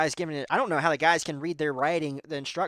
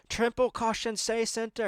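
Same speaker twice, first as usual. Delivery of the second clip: the same note pushed higher and more urgent; insistent co-driver call; 8 semitones higher than usual. Retold or imitated. Co-driver repeating notes to Sammy, more insistent. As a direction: press it harder as he ignores you. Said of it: "this guy, he's like".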